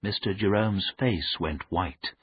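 ordinary delivery, nothing marked

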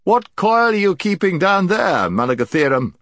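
high ringing shout from above. A narrator's reading. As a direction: call down commandingly. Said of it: "it shouted".

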